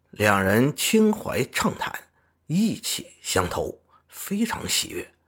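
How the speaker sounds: usual speaking voice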